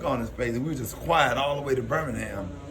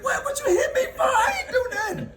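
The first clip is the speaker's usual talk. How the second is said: very high, mock-crying child's whine; hammed-up tearful shriek of protest; wailing protest; 3 dB louder than usual. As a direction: squeal in hurt innocence, laughing underneath. Acts himself as a child protesting.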